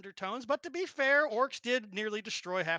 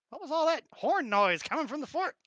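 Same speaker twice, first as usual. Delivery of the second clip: lightly voiced old lady, pitched up; old lady's question; 7 semitones higher than usual. Old lady asks about the horn noise, lightly put-on. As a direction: ask it higher, as the townswoman.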